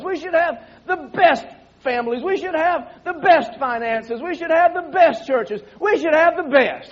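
rousing the congregation with the best; preaching. Acted.

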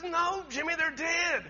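high, loud imitated wife; exasperated retort. Wife telling husband his friends are dead, exasperated. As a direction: push back loudly, pitched well up.

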